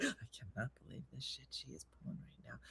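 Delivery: fast whispered, laughing gripe; whispered fury. Retold or imitated. Missy seething over Dina's move, whispered and fast. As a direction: seethe quietly through a laugh. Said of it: "she was just like".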